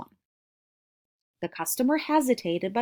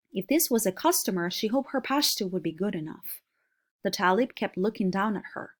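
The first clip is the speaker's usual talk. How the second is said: ordinary delivery, nothing marked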